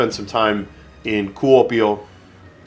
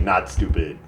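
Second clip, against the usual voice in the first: ordinary talk, nothing marked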